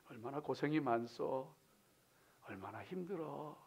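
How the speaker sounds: soft, low words of sympathy; tender consolation. Acted. Believer comforting a weary fellow believer, tender and hushed. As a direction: console them quietly and gently. Voicing consolation to one another.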